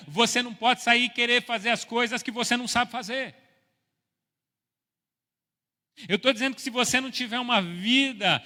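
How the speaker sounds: loud, high, driving preacher's delivery; impassioned pulpit shout, pressing on phrase after phrase; full-force preaching. Real, not acted. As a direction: exhort the congregation at full voice.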